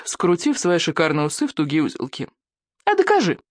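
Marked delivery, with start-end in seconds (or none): 2.8-3.4 put-on very high childish challenge; high, insistent boy voice; boy demanding. A narrator's reading. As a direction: dare him in a piping voice. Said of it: "потребовал Икинг".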